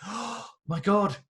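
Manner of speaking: high, slow, awestruck oh my God; gasping in disbelief at a stranger's review; amazed gasp. Retold or imitated.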